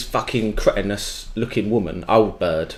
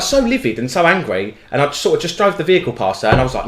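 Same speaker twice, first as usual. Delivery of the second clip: ordinary delivery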